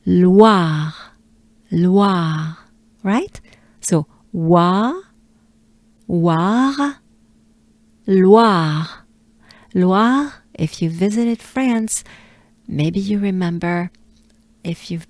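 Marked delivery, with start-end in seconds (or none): 0.0-2.6 Loire as "lwa" plus breathed r; drawn-out Loire built on wa; deliberate Loire, throat r fading out; French Loire. Shown as a demonstration. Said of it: "we are looking for wa". 4.3-10.3 wa, then war, then whole Loire; Loire built up. Shown as a demonstration. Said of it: "we are looking for wa".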